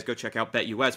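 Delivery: normal talking voice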